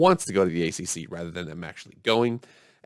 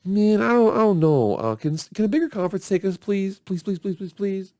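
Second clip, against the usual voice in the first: much higher, pleading mock whine; whiny begging schools; 11 semitones higher, 4 dB louder than usual. Schools begging a bigger conference, high mock whine. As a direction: beg like a desperate little school. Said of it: "the two biggest voices like".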